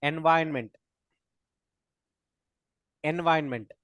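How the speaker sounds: "environment" said as en-VYN-ment; corrected environment. Shown as a demonstration. Teacher models the word for students, corrected pronunciation of environment. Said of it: "It's pronunciation wrong".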